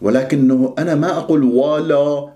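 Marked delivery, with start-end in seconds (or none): 1.4-2.3 "ولا" with thick, dark w and l; wrongly heavy wa-la. Shown as a demonstration. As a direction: make "ولا" heavy as if it were part of the qaf. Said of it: "تفخم الواو واللام لأنها جاورت القاف".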